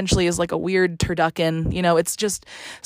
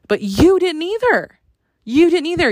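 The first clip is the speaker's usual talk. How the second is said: higher and louder, accusing; indignant rant; 8 semitones higher, 5 dB louder than usual. Retold or imitated. Caller to the agency, accusing them indignantly. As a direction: jab the "you" in disbelief.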